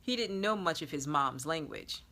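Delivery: plain reading voice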